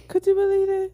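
squealing repeat; high excited ask. Shown as a demonstration. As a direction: throw it up high.

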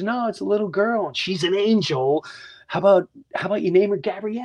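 high-pitched imitation of an odd stranger; slightly off stranger. Stranger in the park approaches expecting parents, imitated odd high voice. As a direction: lift it high, like an oddball passer-by. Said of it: "He's a little off".

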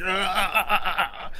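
high staccato "ah ah ah"; lurching body noises. Acted. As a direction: stutter out cries while tottering away. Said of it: "Kanja's body's like".

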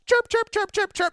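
high, chirpy nag sound; mock nagging chirp. Host mocking the girlfriend who nags about hairs, high mock chirping.

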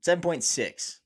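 loud, high, drawn-out score; excited. Real, not acted. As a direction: announce it like a verdict. Announces his drink rating.

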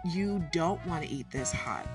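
ordinary delivery

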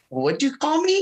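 high, incredulous question; can't believe what she heard; a sharp high what did you say; surprised. Retold or imitated. Retelling her shocked reply.

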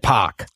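"Park" said as "Pahk"; Boston-style "Park" without the R; dropped R. Shown as a demonstration. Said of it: "never pronounced your R's".

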